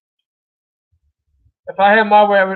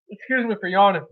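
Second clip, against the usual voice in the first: ordinary talk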